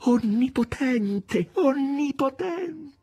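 high, slow, rapt calling; enraptured. A narrator's reading. Margherita rushing to Volant, slow rapt high calling. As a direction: draw it out in rapture. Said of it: "aggiunse rapita".

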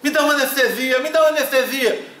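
high, begging plea for relief; mock pleading. Woman in labour begs nurse for anesthesia, high pleading. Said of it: "a conversa da mulherada é só essa".

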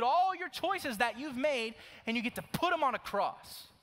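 high, loud sermon about hell and heaven; fiery preaching. Acted. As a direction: preach it with full conviction. Preaching at full force.

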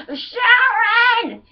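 high, loud, drawn-out demand. Acted. Rock star demanding a sandwich from his wife, yelled impression. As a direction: holler an order at your wife. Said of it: "my [name] impression".

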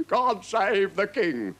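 slow, high ceremonial cry; proclaiming herald. A narrator's reading. Captain closing the royal message, proclaiming. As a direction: draw it out as a loyal call.

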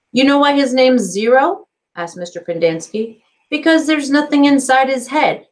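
loud, high jeering joke; mocking counselor. A narrator's reading. Counselor joking about Zero, loud and mocking. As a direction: playfully belittle the boy.

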